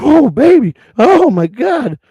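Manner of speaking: high, slow hammed-up moan; mock moan. Imitating Peaches swooning right now, hammed-up high moan. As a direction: play the swooning fan, stretched high. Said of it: "You're right now".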